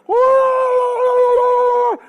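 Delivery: long high shouted whoa; shepherd's call. Retold or imitated. Shepherd calls his sheep back, long and shouted. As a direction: call the flock across the hillside. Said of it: "probably something like".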